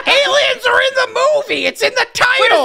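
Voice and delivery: loud, high, laughing retort; a loud, high counter-shout, half laughing. Real, not acted. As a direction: yell back through laughter. Arguing back, laughing.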